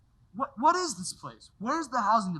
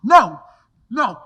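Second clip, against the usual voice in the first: loud, desperate cry of denial; anguished shout; 14 dB louder than usual. Acted. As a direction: refuse to believe it, out loud. George learns his brother died.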